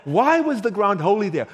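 ordinary talk, nothing marked

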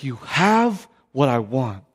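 low, slow, weighty words; grave confrontation. Retold or imitated. Drug dealer pointing at the believer, grave and intent. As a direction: let each word land heavily.

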